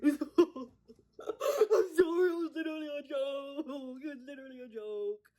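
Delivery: hammed-up hooting apology; friends' imitated guffawing. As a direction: wail it out as if cracking up. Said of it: "His friends are like".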